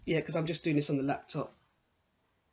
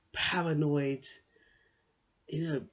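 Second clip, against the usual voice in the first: ordinary talk, nothing marked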